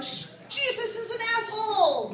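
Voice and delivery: hammed-up blasphemer in church; mock church heckler. Comedian imitating himself shouting in church, mock outburst. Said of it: "gone into a church and gone".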